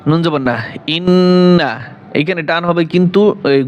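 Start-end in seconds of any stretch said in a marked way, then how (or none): 1.0-1.7 ইন্না with the doubled n held nasally, not stretched; nasal ghunnah. Shown as a demonstration. Teacher showing students ghunnah on ইন্না. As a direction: hum through the nn of ইন্না. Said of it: "sorry গুণ হবে".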